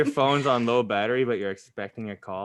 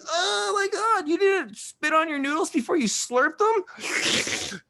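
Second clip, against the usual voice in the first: very high, slow shocked squeal; anime reactor voice; slower than usual. Retold or imitated. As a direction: overreact like an anime side character. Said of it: "one person reacting, being like".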